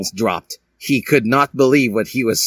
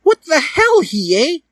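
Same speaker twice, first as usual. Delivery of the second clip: shrieked very high and loud; shouting; 4 dB louder, slower than usual. Acted. Dally catching Hiei after seeing Sasuke, in a very high loud shriek. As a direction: shriek it in outrage. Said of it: "shouted Dally".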